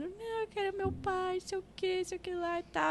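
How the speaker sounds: put-on soft, high child whine; criança chorando. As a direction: imitate a tearful child, quietly and high. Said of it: "mas chorando ainda". (choramingo baixo e agudo de criança)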